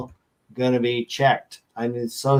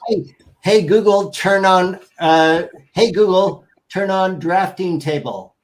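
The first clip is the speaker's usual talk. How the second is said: higher, louder call to a smart speaker; voice command; 6 semitones higher, 3 dB louder than usual. Real, not acted. Calling out a voice assistant command.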